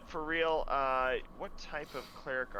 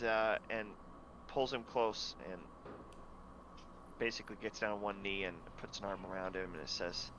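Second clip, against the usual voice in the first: normal talking voice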